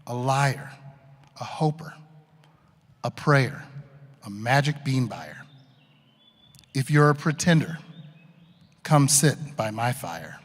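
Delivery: measured, inviting poem reading; poem recitation. Acted. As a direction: read it like an invitation. Reads Shel Silverstein poem aloud.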